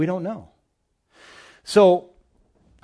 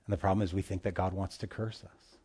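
ordinary talk

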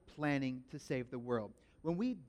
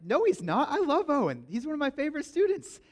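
much higher, louder teacher defending his pupil; high-pitched protest; 10 semitones higher, 4 dB louder than usual. Retold or imitated. Teacher defending Owen to his class, high and loud. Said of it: "I'm like".

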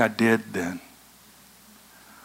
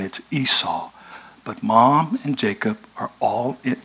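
ordinary talk, nothing marked

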